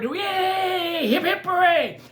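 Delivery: high, loud cheer; high, loud crowd cheer. Acted. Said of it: "they cheered".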